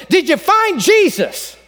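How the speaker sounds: a preacher's patience wearing thin; impatient preacher. Retold or imitated. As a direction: lean on FIND, losing patience. Preacher asks again, growing impatient.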